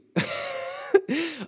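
high burst of laughter. Real, not acted. Loud laugh.

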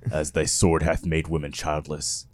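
low, grave biblical pronouncement; solemn scripture voice. Pretend. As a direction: intone it like an ancient prophet. Scripture quote for fight entrance.

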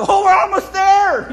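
high, loud, eager; thrilled the door is close; excited again. Retold or imitated.

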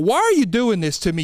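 normal talking voice

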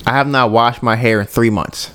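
low, deadpan report; imitating a friend's dead-serious statement; straight-faced. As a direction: say it with a totally straight face. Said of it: "Straight, straight face sentence".

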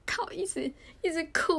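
crying recalled. Real, not acted.

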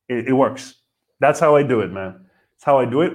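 ordinary delivery

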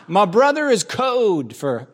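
ordinary talk

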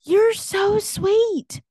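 high, loud, drawn-out gush. Retold or imitated. Thanking the lady for her concern, gushing high and slow. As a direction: stretch it out with sugary gratitude.

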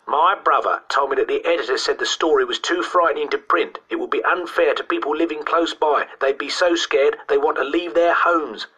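put-on high-pitched boy insisting his tale is true; earnest schoolboy storyteller. Boy defending his brother's story to doubters, insistent.

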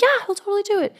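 high-pitched imitation of an eager friend; eager assurance. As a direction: chirp it like a keen friend volunteering someone.

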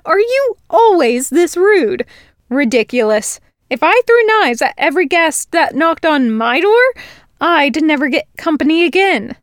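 put-on girl scolding the Fae for his rudeness; indignant human girl. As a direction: tell him off sharply. Girl scolding rude host.

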